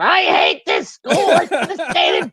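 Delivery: put-on high, slow, shouted kid's wail; mock tantrum wail. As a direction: wail it like a kid kicking doors. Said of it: "he's kicking the doors".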